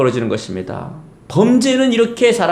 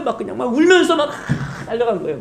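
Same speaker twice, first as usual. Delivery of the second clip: laughing while telling; 5 semitones higher, 3 dB quieter than usual. Acted. Describing David running, crying.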